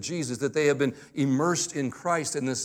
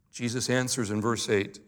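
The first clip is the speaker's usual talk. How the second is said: ordinary talk, nothing marked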